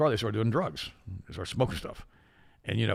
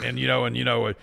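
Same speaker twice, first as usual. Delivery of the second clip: normal talking voice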